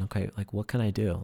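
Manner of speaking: ordinary talk, nothing marked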